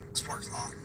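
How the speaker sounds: ordinary delivery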